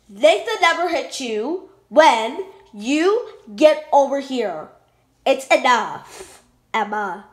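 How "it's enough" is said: ordinary talk, nothing marked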